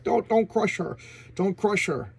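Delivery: high-pitched mock begging; acted plea. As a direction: plead for her, playing it up. Voicing villain pleading for her.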